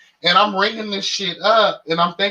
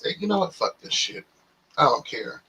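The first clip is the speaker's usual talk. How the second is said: starts soft and drops to a whisper; fading to a whisper; 7 dB quieter than usual. Retold or imitated. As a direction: give up and hiss it under your breath. Gives up on the jacket.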